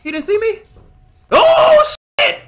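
high startled shriek; panicked yelp. Real, not acted. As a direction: yelp in sudden fright. Chased by monster in game.

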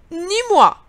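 high, loud duck squawk; quacking duck. Acted. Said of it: "cancana le canard".

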